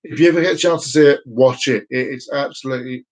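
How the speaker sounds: usual speaking voice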